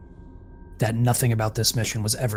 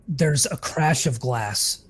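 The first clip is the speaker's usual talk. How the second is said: ordinary delivery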